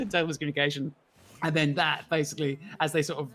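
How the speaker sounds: ordinary talk, nothing marked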